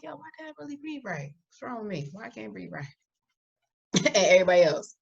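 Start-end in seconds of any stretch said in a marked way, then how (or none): 0.0-2.8 soft, hushed self-question; musing to herself. Retold or imitated. Noticing dad breathes right, asking softly what's wrong. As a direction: wonder it quietly to yourself. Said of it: "I was like".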